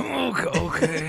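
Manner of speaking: squeaky-high, loud mock crack. Teasing the friend whose voice just moved, mock cracked voice.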